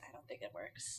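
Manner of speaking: whispering it softly; breathy whisper of doubt. Real, not acted. Repeats opinion in whisper.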